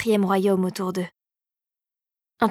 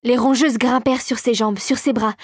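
ordinary delivery, nothing marked

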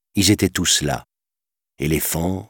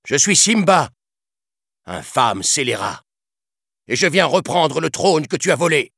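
full of anger; 11 semitones higher than usual. A narrator's reading. Young lion challenging his father's killer, angry. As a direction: confront the murderer head-on. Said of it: "D'une voix emplie de colère".

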